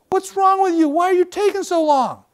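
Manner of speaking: high, loud impatient outburst; loud high exasperated complaint; annoyed yell. Retold or imitated. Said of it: "He says".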